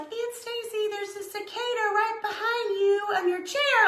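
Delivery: put-on high, small child's voice, matter-of-fact; little nephew. Nephew telling his aunt about a bug, high calm kid voice. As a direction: pitch it up like a calm little boy. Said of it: "my sweet little nephew was like".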